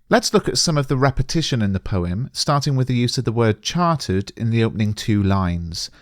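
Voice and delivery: normal talking voice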